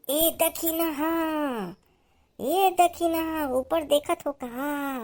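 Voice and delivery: high, drawn-out call for attention; urgent hail. Acted. Urging companion to look up.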